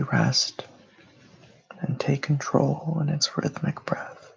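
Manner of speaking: unhurried meditation voice with long pauses; guided meditation. Real, not acted. Host leading listeners through breathing and grounding, meditative delivery. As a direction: guide them into calm, phrase by phrase.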